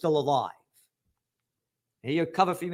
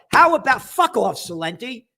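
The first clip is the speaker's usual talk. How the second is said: angry outburst; 5 semitones higher, 4 dB louder, slower than usual. Real, not acted. Ranting at the bank chief.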